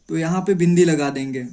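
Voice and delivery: ordinary talk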